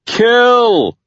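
very loud, high sustained yell; full-breath holler. A narrator's reading. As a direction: holler it with all your breath. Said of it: "Tucker hollered, using all his breath".

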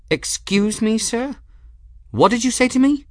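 can't believe what she heard; indignant shock. A narrator's reading. Gwen shocked by curse.

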